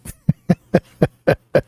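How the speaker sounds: voiceless, pulsing laugh; real amused chuckle. Real, not acted. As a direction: laugh in short airy bursts. Laughs after joke about drinking.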